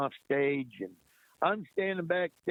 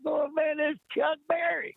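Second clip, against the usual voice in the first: high excited shout; starstruck shout; 14 semitones higher than usual. Retold or imitated. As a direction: yell it like a stunned fan meeting his idol. Starstruck reaction retold.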